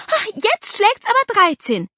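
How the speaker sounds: high, loud exasperation; indignant outburst. A narrator's reading. Aunt losing patience with the children, indignant. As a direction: explode as if banging the table. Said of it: "Irma schlug auf den Tisch".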